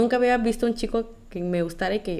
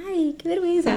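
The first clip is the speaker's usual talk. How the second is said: high, giggly and embarrassed; embarrassed giggle; 9 semitones higher than usual. Retold or imitated. Girl recalling a kiss to her friend, high embarrassed giggle. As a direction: squeal it out through a laugh. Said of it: "yo como que toda".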